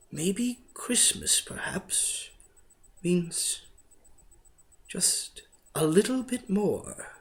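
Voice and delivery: slow, soft whisper; whispered thought. A narrator's reading. The Grinch whispers his realization to himself. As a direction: breathe the thought. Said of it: "he thought".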